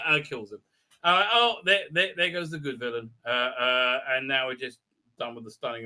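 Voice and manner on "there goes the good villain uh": usual speaking voice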